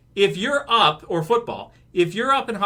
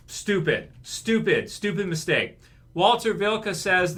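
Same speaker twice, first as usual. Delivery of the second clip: normal talking voice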